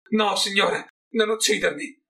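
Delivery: high, frightened begging; pleading. A narrator's reading. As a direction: beg the rat lord for your life. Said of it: "lo supplicò".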